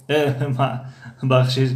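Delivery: ordinary delivery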